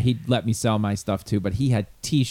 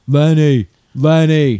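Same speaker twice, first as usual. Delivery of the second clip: loud, higher holler of a name; two loud, pitched-up mock shouts; imitated calling out; 4 semitones higher, 9 dB louder, much slower than usual. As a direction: call him over, mimicking. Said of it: "you'd hear".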